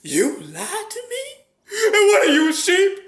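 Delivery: very high, loud, squeaky cartoon dragon; Mushu impression. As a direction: shriek it in outrage like a tiny dragon. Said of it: "Mushu from Mulan".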